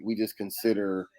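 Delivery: normal talking voice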